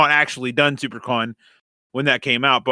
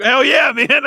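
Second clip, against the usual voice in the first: enthusiastic; 11 semitones higher, 6 dB louder, slower than usual. Retold or imitated. Enthusiastic retold reply.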